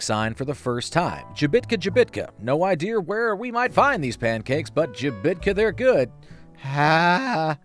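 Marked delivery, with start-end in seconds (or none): none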